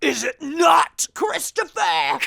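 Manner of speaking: slow, high hammed-up crying wail; mock wail. Host pretending the co-host hurt her, hammed-up crying. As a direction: wail it like fake heartbreak.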